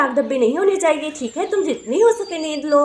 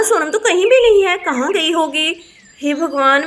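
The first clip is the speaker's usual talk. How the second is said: ordinary talk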